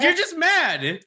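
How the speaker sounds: mock needling in a high voice; high teasing taunt. Real, not acted. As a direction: needle him high and sing-song. Teasing the host who lost.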